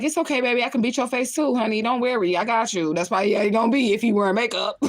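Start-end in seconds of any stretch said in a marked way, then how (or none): 0.0-2.9 lightly voiced sweet-talking boyfriend, a bit higher; mock-soothing lover's voice, pitched up; imitating her man. Guessing how her man reassures her, mock tender. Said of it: "He probably gonna be like".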